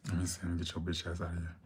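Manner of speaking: quick, soft cursing dismissal; cursing version. Real, not acted. Streamer blocking a rude commenter, quick soft cursing. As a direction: mutter the insult fast under her breath. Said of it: "I'm trying to stop cursing".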